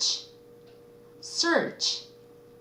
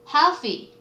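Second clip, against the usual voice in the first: usual speaking voice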